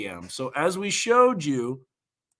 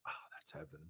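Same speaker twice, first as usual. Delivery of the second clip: whisper; 11 semitones lower, 26 dB quieter, faster than usual. Real, not acted. Sipping the slushy, blissed out.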